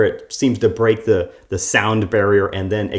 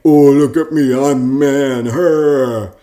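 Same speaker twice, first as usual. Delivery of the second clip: higher, much louder hammed-up caveman boast; mock macho man; 4 semitones higher, 8 dB louder, slower than usual. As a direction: puff up and bellow like a cartoon tough guy. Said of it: "her interpretation of how men act".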